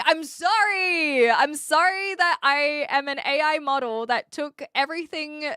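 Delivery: over-the-top fake contrition, easing off as it goes; mock apology. Streamer answers a copying accusation with a hammed-up apology.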